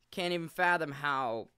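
ordinary delivery

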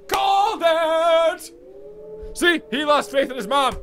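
loud high gloat, stretching out called; triumphant gloating. Real, not acted. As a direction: crow about being right. Prediction came true, gloating.

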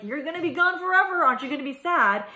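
teasing mock taunt. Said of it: "he's telling her, like".

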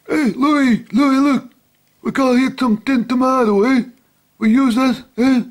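put-on high, loud Italian-American mafioso accent; mafia voice. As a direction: ham up an Italian gangster calling to his pal. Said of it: "not doing my best mafia voice".

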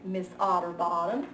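ordinary delivery, nothing marked

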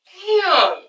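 usual speaking voice

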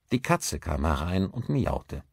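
ordinary delivery

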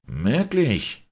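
amazed doctor calling out; stunned one-word question; astonished. A narrator's reading. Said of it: "rief der erstaunte Doktor".

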